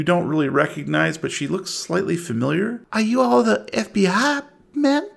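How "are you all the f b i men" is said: lightly voiced anxious mother, pitched up; a mother asking the agents, higher; a hint of anxious small-town woman; worried mother. Game master voices worried mother.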